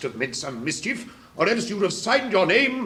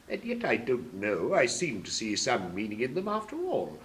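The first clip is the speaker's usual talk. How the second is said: musing aloud; 5 semitones lower, 7 dB quieter than usual. Acted. King puzzling over the verses, low and soft. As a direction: lower and softer musing.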